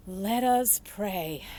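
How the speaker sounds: loud, very slow preacher voice; solemn preacher. A narrator's reading. Preacher calls the church to pray for the mouse, slow and loud. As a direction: draw it out from the pulpit.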